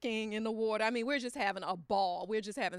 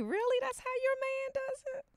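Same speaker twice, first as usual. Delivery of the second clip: much higher, incredulous squeal; mock disbelief. Teasing a coworker about her husband, squealing disbelief. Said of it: "I'm like".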